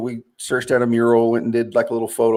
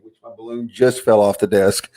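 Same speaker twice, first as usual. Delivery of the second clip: normal talking voice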